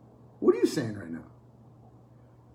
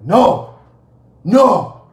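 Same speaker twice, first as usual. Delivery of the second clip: loud, higher mock protest; loud objection; 5 semitones higher, 12 dB louder than usual. Objectors interrupting the preacher, loud mock protest. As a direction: cut in with a booming refusal.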